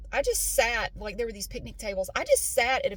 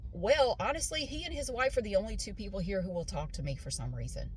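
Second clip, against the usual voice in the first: normal talking voice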